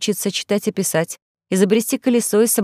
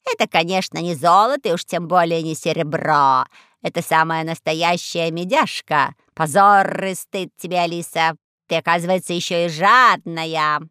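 raspy cawing crow. Acted. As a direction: drag it out like a sly crow. Crow scolds Alice.

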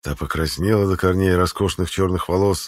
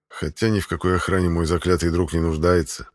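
usual speaking voice

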